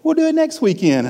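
high, cheerful mock client voice; imitated easygoing reassurance; breezy client. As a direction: pitch it way up, carefree and obliging. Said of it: "of course, he's like".

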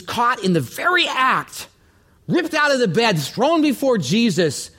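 heightened, emphatic storytelling; dramatic retelling. Real, not acted. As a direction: dramatize the scandal, voice climbing high. Preacher dramatizes the woman's seizure.

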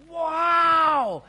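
a big, loud shout of wonder; acted crowd shouting wow; amazed wow. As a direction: cry it out as if awestruck.

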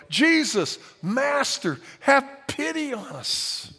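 high, loud, drawn-out plea; desperate yell. Retold or imitated. Ten lepers calling to Jesus from afar, high pleading yell. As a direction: cry out to him across the distance, begging. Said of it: "they yelled".

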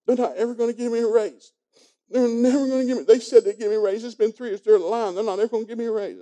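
a daughter wailing about no raise at the bank; upset daughter. Retold or imitated. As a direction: act out the grown daughter upset and venting. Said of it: "she's sitting on the couch crying".

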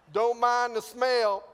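high, loud, slowed-down excuse; comic shaken pilot. Acted. Shaken pilot to airport owner about the aircraft, high and loud. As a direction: raise the voice and stretch it out.

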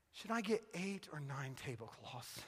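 low, very soft retold question; hesitant wife after pausing. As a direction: imitate your wife asking quietly, as if gravely important. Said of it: "She pauses".

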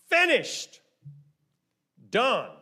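high, loud one-word proclamation; loud cry. Acted. Preacher voicing Jesus' last word from the cross, loud cry. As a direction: cry each word out on its own, pausing between. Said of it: "he cried out with a loud voice".

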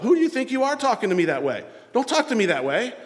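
mock-indignant walls-up reply; defensive retort.

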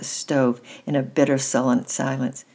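usual speaking voice

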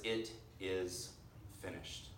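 slow, soft and reverent, fading almost to nothing; hushed. Acted. Preacher to his congregation, a soft reverent hush. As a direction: let the words die away into near silence. Quoting Jesus' last words.

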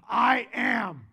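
I AM thundered out loud; booming proclamation. Acted. Preacher booming God's words to Moses, loud proclamation. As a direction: proclaim God's name with full force.

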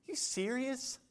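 acted disbelief, kept very small; hushed incredulity. Preacher acts a listener balking at grace, soft high disbelief.

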